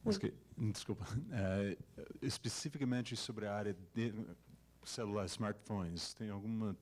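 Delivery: Portuguese with an English accent; American speaker in careful learner Portuguese. Real, not acted. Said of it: "Desculpa o meu português".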